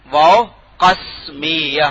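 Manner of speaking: slow, each syllable separated; slow dictation. Shown as a demonstration. Teacher giving students a grammar term, slow dictation. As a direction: say واو قسمیہ slowly for note-takers.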